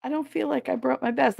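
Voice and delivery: put-on high, small, sheepish girl voice; sheepish friend. Friend admits she didn't bring her best, high sheepish. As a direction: mimic her meek admission. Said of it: "she's like".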